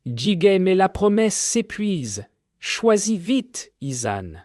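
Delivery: high eerie voice of the sea spirit; sea spirit speaking. Acted. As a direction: pitch it high and otherworldly. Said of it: "dit-elle". (voix aiguë et surnaturelle de l'esprit de la mer)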